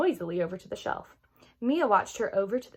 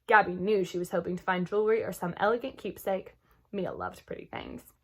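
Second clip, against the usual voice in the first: usual speaking voice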